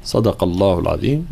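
normal talking voice

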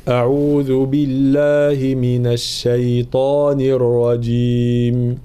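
slow, chanted isti'adha; Quran recitation. Shown as a demonstration. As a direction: recite slowly for the class to repeat. (تلاوة بطيئة للتلقين)

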